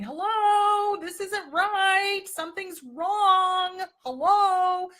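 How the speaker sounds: shrill, very high, very loud alarm call; a frantic, slowed "Hello" shouted at full volume; body screaming. Pretend. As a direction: yell it like your body sounding an alarm. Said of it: "our body is literally screaming at us going".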